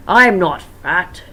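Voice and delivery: slow, loud offended growl; angry denial. Acted. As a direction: push back angrily and slowly. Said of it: "Freddy stared at him angrily".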